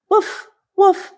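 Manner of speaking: high yappy little puppy woofs; puppy bark. Acted. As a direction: bark like a tiny excited puppy. Puppy barks in story.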